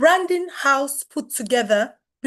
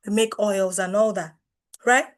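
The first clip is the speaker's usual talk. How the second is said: ordinary delivery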